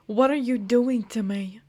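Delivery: ordinary delivery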